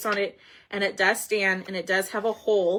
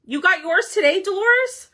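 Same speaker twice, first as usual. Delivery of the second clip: surprised; 8 semitones higher, 5 dB louder than usual. Real, not acted. Greeting viewer who got kit.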